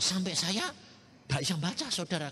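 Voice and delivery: usual speaking voice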